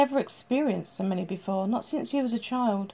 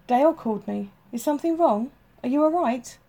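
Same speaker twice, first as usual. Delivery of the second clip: quick, worried and a bit louder; hurried, scared; 4 semitones higher, 4 dB louder than usual. Acted. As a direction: rush the questions out in alarm. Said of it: "Her voice hurried with each word".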